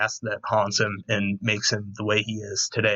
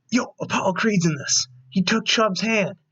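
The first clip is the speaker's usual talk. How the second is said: high-pitched, thrilled recognition; excited fan reaction; 9 semitones higher than usual. Retold or imitated. Fan retelling his reaction to Apollo Creed, excited. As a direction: relive spotting a favorite actor, pitched up.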